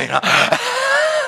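sudden high real laugh; a bright real laugh at his own joke. Real, not acted. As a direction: burst out laughing, high and loud.

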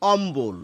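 অম্বল said ombol, b clearly sounded; teacher sounds the b in অম্বল; b pronounced. Shown as a demonstration. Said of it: "বয়ের উচ্চারণ কিন্তু আমরা করছি".

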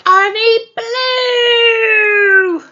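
high loud drawn-out blow; big blow. Acted. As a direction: blow it out hard. Wolf blows house down.

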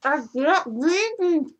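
slow, garbled, mouth crammed; drooling through marshmallows; cheeks stuffed full. Real, not acted. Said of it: "Before, I had rabies".

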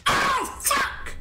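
very high-pitched yelped curse; sudden high outburst; alarmed shriek. Real, not acted. Swears in alarm during play.

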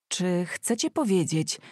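ordinary delivery, nothing marked

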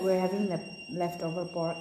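normal talking voice

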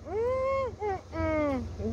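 pinched high hums of sourness; wincing at sour fruit; sour-face groans. Retold or imitated. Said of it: "A cara do senhor Gabriel comendo tamarino". (gemido de azedo)

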